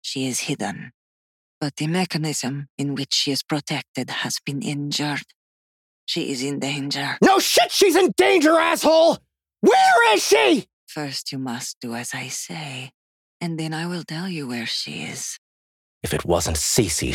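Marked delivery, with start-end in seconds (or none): 0.0-7.2 high, soft, measured explanation; controlled possessed voice. A narrator's reading. Possessed woman explains calmly. 7.2-10.7 very high, loud outburst; furious shouting. A narrator's reading. Furious demand for missing girl. 10.9-15.4 high, very soft bargaining; controlled possessed voice. A narrator's reading. Possessed woman sets her condition.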